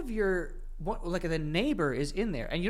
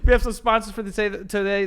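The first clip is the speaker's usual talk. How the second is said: laughing; 4 semitones higher, 5 dB louder, slower than usual. Real, not acted. Laughing into sponsor read.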